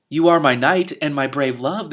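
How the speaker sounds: loud, slow melodramatic declaration; dramatic. A narrator's reading. Princess declaring love to her knight, dramatically. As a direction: declaim it like a stage heroine. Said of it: "the princess said dramatically".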